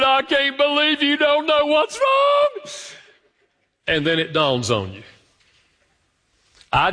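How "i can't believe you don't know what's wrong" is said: upset wife. Retold or imitated. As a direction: cry it out as the wounded wife. Crying wife retort quoted.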